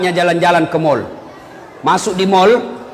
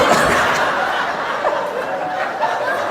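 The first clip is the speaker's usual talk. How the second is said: high breathless laugh; 5 dB quieter than usual. Real, not acted. Preacher laughs at own joke.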